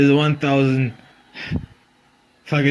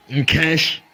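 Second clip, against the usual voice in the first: ordinary delivery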